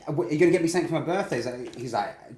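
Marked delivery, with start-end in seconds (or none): 0.1-1.4 put-on pestering kid. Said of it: "I was like".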